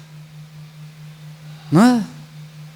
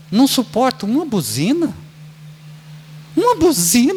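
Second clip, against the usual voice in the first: mock irritation; 4 semitones higher than usual. Irritated driver voiced.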